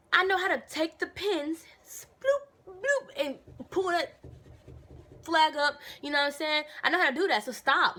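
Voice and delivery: high, loud exasperated snap back at the sergeant; exasperated retort. Retold or imitated. To the sergeant picking at her over the flag, high loud exasperation. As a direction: tell him off, fed up, pitch way up. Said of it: "I'm like".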